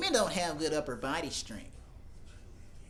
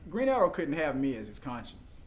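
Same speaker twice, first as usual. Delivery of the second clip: usual speaking voice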